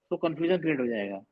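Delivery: normal talking voice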